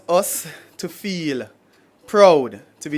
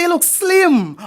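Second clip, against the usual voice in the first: a prim English voicing of slim; English-style quote; 14 semitones higher, 5 dB louder, much slower than usual. Poet imitating English speakers, prim quote. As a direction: say slim crisply like an English person. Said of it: "When English people describe somebody, them say".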